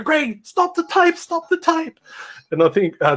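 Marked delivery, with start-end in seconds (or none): 0.0-2.0 high, drawn-out urgent holler; retold yell. Musician yelling out to the sound guy, urgent. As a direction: re-enact calling across a venue in panic. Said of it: "So I had to yell out to our sound guy".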